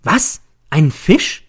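high-pitched, incredulous exclamation; startled cry. A narrator's reading. Narrator reacts to news of the fish, startled cry. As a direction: jump up and cry out in disbelief. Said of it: "Ich schnelle empor und schreie".

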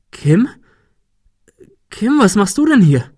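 high, loud, startled question; astonished. A narrator's reading. Adrian seeing a colleague come out of the fog, astonished. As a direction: call the name in disbelief, then ask. Woman appears unexpectedly.

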